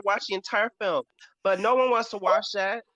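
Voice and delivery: ordinary delivery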